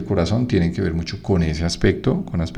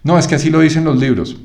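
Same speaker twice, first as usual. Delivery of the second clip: high, louder defensive student voice; mock student excuse; 7 semitones higher, 5 dB louder, faster than usual. Teacher mocking a student's excuse, high and loud. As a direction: whine it out as the student who hides behind the books.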